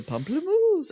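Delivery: British accent, playful rising mimicry; parroting Harry's accent. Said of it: "a parrot".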